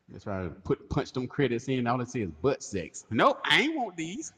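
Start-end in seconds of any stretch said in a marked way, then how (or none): 3.1-4.3 mock refusal. Acting out refusing the credits.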